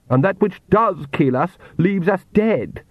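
high, slow sarcastic snarl. A narrator's reading. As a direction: snarl it back, drawing out the words. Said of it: "snarled Lacrimosa".

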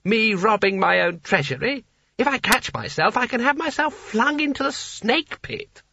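a giddy rich man rising to a squeak; gleeful sultan. Acted. Creosote delights in the idea.

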